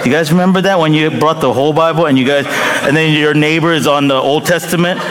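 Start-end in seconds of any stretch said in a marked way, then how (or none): none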